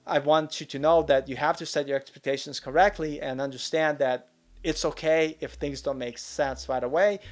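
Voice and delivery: ordinary talk, nothing marked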